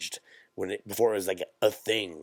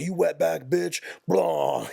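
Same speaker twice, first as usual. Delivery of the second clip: higher, louder mock snarl as his father; imitating abusive dad; 8 semitones higher, 3 dB louder, slower than usual. Comedian mimicking his father yelling at his mother, mock snarl. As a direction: voice the drunk father's slur mockingly. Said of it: "my dad's like".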